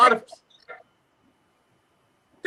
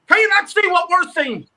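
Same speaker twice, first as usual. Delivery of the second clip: ordinary delivery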